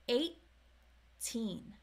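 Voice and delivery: put-on eighteen with a stopped T before teen; held T. Shown as a demonstration. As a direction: hold the T in eighteen, then release on teen. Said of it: "there is a held T".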